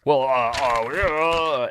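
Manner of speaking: mocking, hemming and hawing mayor; drawn-out dithering noises in mockery; John Whitmire. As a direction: imitate a politician waffling helplessly. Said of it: "doing the whole".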